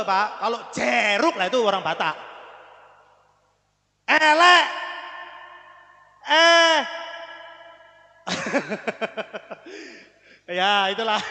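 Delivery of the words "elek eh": "Elek" and "Eh" loud and higher, long open E; heavy E. Shown as a demonstration. Teacher demonstrating the vowel to the class, loud and stretched. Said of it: "ada titik tiga jadinya E".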